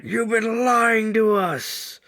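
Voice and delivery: high, loud, slow accusing cry; accusing outcry. Retold or imitated. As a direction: yell it like a betrayed teenage son. Sons confront father about secret.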